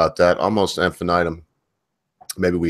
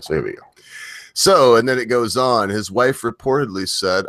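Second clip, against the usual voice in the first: usual speaking voice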